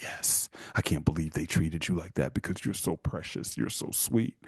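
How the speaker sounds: soft, low mock crooning; hushed imitation of a doting rescuer; smitten boyfriend. Boyfriend comforts wronged girlfriend, mock tender. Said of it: "He's like".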